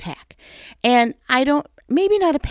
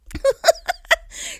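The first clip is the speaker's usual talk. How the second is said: high laugh. Real, not acted. Laughing at her own prayer.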